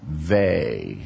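they said as a low, drawn-out vay; patient rabbi. Acted. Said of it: "very patiently looks at him and he says".

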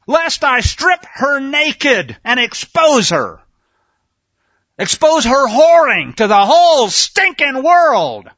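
loud, high, slow thundering sermon; fiery preaching. Acted. Preacher denouncing the whoring nation, full-force preaching. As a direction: hurl scripture like a judgment.

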